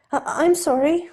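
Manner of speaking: high, loud, flustered sorry; startled apology. Acted. Steed startled after knocking Ed into the coats, flustered. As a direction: blurt it, caught off guard. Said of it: "Steed starts".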